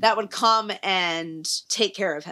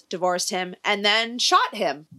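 ordinary talk, nothing marked

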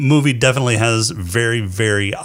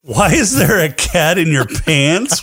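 mock outrage; 6 semitones higher, slower than usual.

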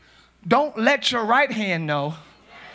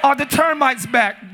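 usual speaking voice

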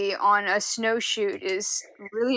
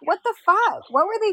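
high, stunned outrage; outraged disbelief; 10 semitones higher than usual. Real, not acted. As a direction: let it burst out in shock, pitched up. Friend outraged by story.

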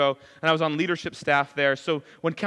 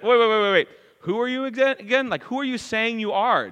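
acted disbelief, pitched up; baffled challenge; 6 semitones higher than usual. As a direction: cut in with a high, puzzled demand. Acts baffled listeners challenging Jesus.

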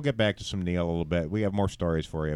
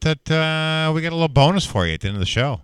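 loud, high announcer read; breaking news announcement; 6 semitones higher, 6 dB louder than usual. Acted. Host breaking news to listeners, loud announcer voice. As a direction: announce it like a bulletin. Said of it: "so this just in".

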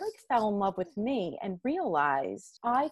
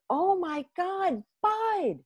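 amazed; 12 semitones higher, much slower than usual. Retold or imitated. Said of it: "And I was like".

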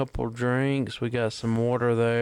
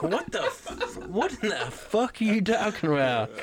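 laughing disbelief; 6 semitones higher than usual. Retold or imitated. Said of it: "And I'm like".

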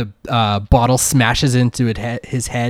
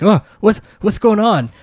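startled elf; 8 semitones higher than usual. Acted. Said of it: "He's like".

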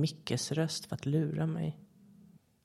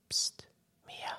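a hushed psst and her name; whisper; 4 dB quieter than usual. A narrator's reading. The voice behind her calling Mia, whispered.

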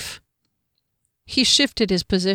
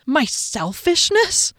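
slow, high-pitched disbelieving echo; drawn-out, affronted question; incredulous offence; 8 semitones higher, slower than usual. Acted. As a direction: repeat the accusation in astonishment. Heroine shocked by the accusation.

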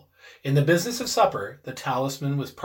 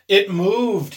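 louder startled cry; cried out; 6 semitones higher, 9 dB louder than usual. A narrator's reading. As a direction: blurt it out in shock. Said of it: "he cried".

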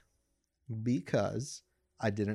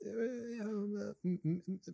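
nervous stammer; 8 semitones higher, 5 dB quieter than usual. Retold or imitated. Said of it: "I'm like".

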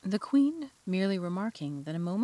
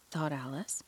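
usual speaking voice

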